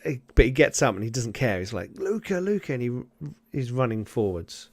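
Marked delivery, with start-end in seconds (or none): 1.9-2.7 calling for son. Acted. Hurt father running on after his son, calling out. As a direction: call the name twice, pitched high.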